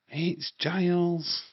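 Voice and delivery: "Giles" drawn out slowly, with weight on the final S; slow stressed name. Shown as a demonstration. Telling viewers the Watcher's name, slow emphasis on "Giles". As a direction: spell out "Giles" by ear, slow and deliberate. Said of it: "It's got an S on the end".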